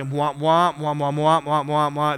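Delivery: Charlie Brown teacher womp womp; muffled trombone-like droning; muffled wah wah talk. Retold or imitated. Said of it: "like the teacher in Charlie Brown".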